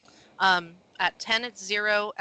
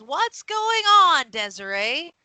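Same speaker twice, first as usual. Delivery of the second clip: raised, drawn-out call, high then dropping; 6 semitones higher, 7 dB louder, slower than usual. Acted. Elven man asks Desiree about the commotion, calling out. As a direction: holler it slowly across the yard.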